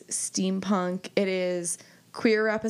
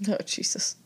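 whispered; 4 dB quieter than usual. Real, not acted. Reacting to cry recommendation.

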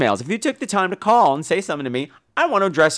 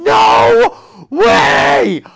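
high, loud shocked shout; shocked yell; 13 semitones higher, 9 dB louder, much slower than usual. Retold or imitated. Host jumping up at the movie's twist, loud shocked yell. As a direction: scream in disbelief off the couch.

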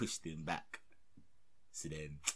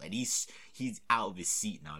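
usual speaking voice

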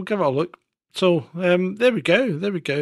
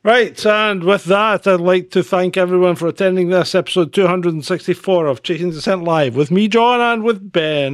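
formal sign-off; 5 dB louder than usual. Acted.

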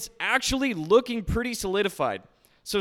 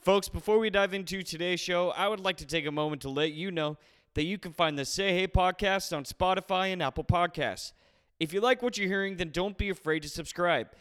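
podcast intro read; 4 dB quieter than usual. Acted.

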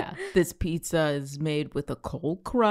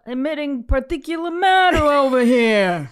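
higher, louder pizza character boasting; mock pizza voice; 7 semitones higher, 6 dB louder than usual. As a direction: play the smoky pizza bragging. Playing the polluting pizza.

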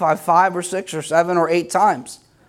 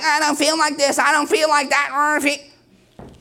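higher-pitched mimicry of complainers; mock complaining; 6 semitones higher than usual. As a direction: mimic people griping about their feelings, higher. Mimicking people who complain.

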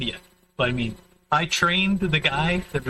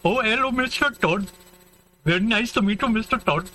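higher-pitched imitation of an Indian accent; mock Indian accent; 7 semitones higher than usual. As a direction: greet politely in a put-on Indian accent. Mimicking Indian call center worker in film.

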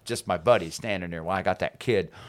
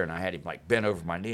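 ordinary talk

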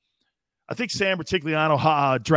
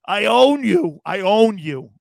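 louder, higher mock gloat; Aaron Rodgers taunting; 4 semitones higher, 8 dB louder, slower than usual. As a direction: lord it over the fans. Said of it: "He's telling Bear fans".

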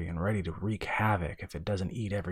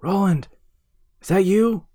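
loud, high, excited shouting; calling out; 8 semitones higher, 8 dB louder than usual. A narrator's reading. Brother surfacing with the bottle, calling out excitedly. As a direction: shout across the dark water in breathless relief. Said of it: "His brother's voice called for him".

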